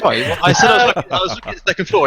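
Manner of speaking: high, fast laughing protest. Real, not acted. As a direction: defend yourself through laughter. Laughing defensive apology.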